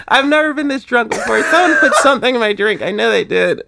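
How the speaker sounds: put-on high, slurred drunk girl; drunk chick voice. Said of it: "your drunk chick voice".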